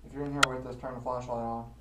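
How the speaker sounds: loud call to an unseen spirit. Real, not acted. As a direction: project it into the dark room. Calling out to the spirit.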